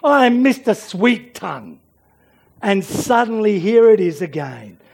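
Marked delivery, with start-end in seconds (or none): 0.0-1.8 loud, high-pitched acted protest; mock indignation. Someone shocked at their own words, mock indignation. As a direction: protest loudly in hammed-up dismay. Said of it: "it's out there and you think".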